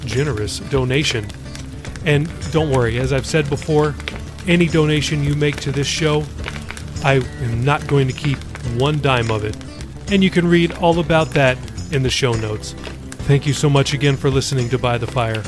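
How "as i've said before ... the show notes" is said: usual speaking voice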